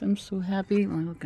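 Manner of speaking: ordinary talk, nothing marked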